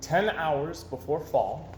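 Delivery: usual speaking voice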